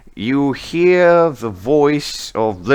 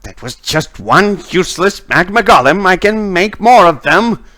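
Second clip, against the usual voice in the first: Lilith; 8 semitones higher, 3 dB louder than usual. Acted. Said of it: "she's just like".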